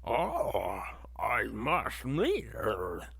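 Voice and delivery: slow, dreamy mock leading-man voice; mock smitten stalker. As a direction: drag it out like a lovesick film hero. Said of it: "and he's like".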